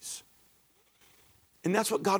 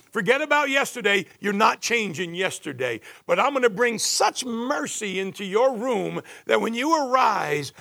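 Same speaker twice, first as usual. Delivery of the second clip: full-force preaching; 5 semitones higher, 3 dB louder than usual. Acted. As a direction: preach it out and build the fervour.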